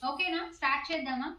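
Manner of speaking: normal talking voice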